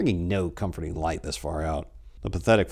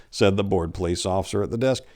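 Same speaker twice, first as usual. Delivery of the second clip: plain reading voice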